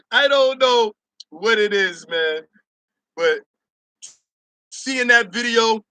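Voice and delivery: ordinary talk, nothing marked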